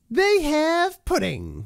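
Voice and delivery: very high, very slow put-on ad voice; ad tagline. Quoting the old ad tagline in a squeaky drawn-out voice. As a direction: drag out a goofy squeaky ad line.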